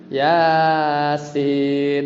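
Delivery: "Yasin" with "Ya" dragged long like "sin"; sung, stretched-out "Yasin"; wrong habitual recitation. Shown as a demonstration. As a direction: chant both syllables of "Yasin" overlong. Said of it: "salah tuh bacanya".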